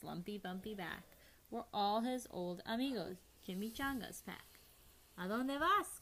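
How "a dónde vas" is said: higher, louder call; raised, carrying question. A narrator's reading. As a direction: call it out to someone across the water. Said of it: "called out Skipito".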